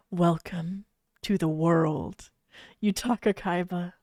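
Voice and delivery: mother greeting her newborn softly; whispered. A narrator's reading. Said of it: "Anzu whispered".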